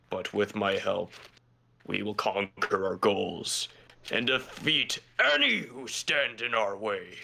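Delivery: slow, measured vow; solemn heroic pledge. Acted. Character vowing to stand by a comrade, solemn. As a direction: swear it slowly and gravely, building at the end.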